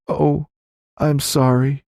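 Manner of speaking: a widow rushing to confess her fears; urgent widow. Acted. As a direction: Violet confiding in the sheriff. Violet near tears.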